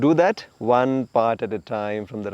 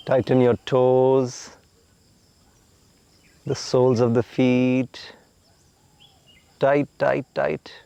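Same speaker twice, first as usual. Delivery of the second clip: guided relaxation. Acted. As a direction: talk them gently into letting go.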